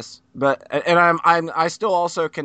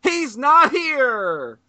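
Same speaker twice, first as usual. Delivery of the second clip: announcer build-up; 8 semitones higher, 4 dB louder, slower than usual. Pretend. Introducing a special guest.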